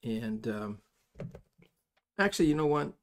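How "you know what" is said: normal talking voice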